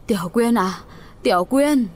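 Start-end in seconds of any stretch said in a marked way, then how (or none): 1.2-1.9 frantic cry. Acted. Old man running cries for the girl, frantic. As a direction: cry out the name in alarm. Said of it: "vừa hớt hải kêu lên".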